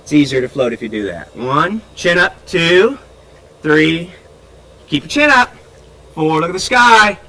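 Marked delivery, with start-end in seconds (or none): 1.4-7.2 calling out loud over the water; raised voice. Real, not acted. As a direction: call loud so a swimmer with ears underwater can hear. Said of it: "I have to raise my voice so she can hear me".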